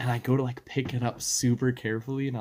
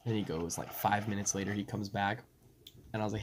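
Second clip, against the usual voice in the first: ordinary delivery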